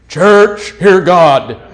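loud, grand proclamation; mock proclamation. Mystic announcing to the whole church, loud and grand. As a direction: boom it out like a self-appointed prophet.

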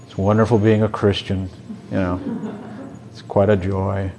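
deadpan misery about supposed joy; long-faced. Retold or imitated. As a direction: imitate a joyless, long-faced believer. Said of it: "who put a long face on".

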